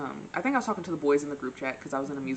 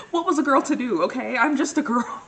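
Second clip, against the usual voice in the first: loud, high theatrical wail; hammed-up helplessness; 7 semitones higher, 9 dB louder than usual. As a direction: protest at full volume, playing helpless.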